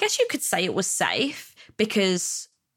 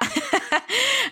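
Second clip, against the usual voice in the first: laugh. Real, not acted. Laughing off her own joke.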